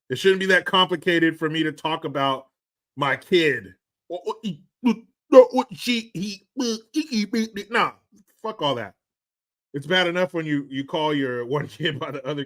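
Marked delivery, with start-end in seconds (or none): 4.1-7.9 high, stumbling mock of pronoun confusion; hammed-up pronoun fumbling; mock stammer. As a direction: stumble over he and she on purpose.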